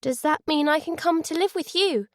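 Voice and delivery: put-on high, hopeful little girl; tentative hope in a small high voice; hopeful child. A narrator's reading. Daughter asks to live with her father, hopeful. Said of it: "Naomi's hope was even more painful".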